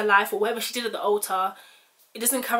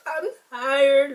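tearful prayer; 5 semitones higher, much slower than usual. Retold or imitated. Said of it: "like, crying and she's like".

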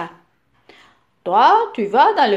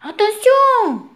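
much higher and louder warning cry, with a laugh; shouting; 10 semitones higher, 6 dB louder than usual. Acted. Papa warning his son about a spider in the goal, loud mock-alarmed cry. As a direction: yell the warning, pretending to panic. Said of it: "crie papa".

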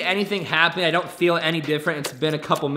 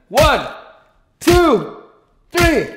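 loud count; 8 semitones higher, 5 dB louder, much slower than usual. Real, not acted. Counting before hitting head.

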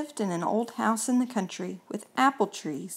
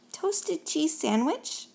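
higher, slow and gentle ghost voice; friendly ghost offer; 7 semitones higher, 4 dB quieter, slower than usual. Acted. Gus offering the hungry mouse a toasted cheese sandwich, gentle and high. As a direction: offer it kindly and slowly.

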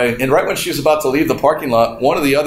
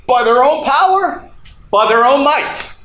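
preaching climax; 10 semitones higher than usual. Acted.

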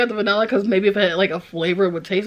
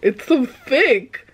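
ordinary talk, nothing marked

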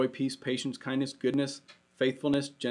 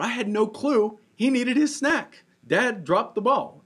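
higher and louder, playful self-scolding; mock self-blame; 10 semitones higher, 6 dB louder than usual. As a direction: own the blunder with a comic wail. Pastor mocking his own parenting slip.

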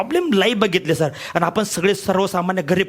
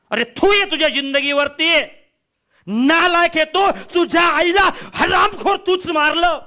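angry self-scolding; 9 semitones higher than usual. Acted. Speaker berating himself for his mother's death, a furious high rebuke. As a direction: spit the words at your own weakness.

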